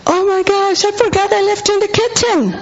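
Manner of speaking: high startled yell of forgetting the milk; panicked scream. Retold or imitated. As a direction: shriek it in sudden alarm, rushing. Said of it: "I screamed".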